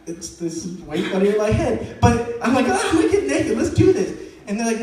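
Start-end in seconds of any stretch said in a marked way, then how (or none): 2.7-4.2 put-on high-pitched gleeful kid; hammed-up, higher-pitched enthusiasm; mock eager excitement. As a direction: jump at the dare with glee. Kid eagerly accepts the dare.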